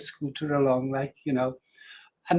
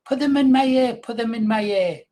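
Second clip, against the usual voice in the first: put-on higher and louder, a small child insisting; little girl pleading; 7 semitones higher, 4 dB louder than usual. Grandfather imitating his granddaughter begging for daisies, high and eager. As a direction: imitate a five-year-old begging for daisies in her hair.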